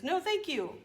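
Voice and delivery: playful high-pitched refusal; wolf refusing. Acted. Wolf reacts to the axe.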